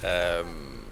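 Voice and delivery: usual speaking voice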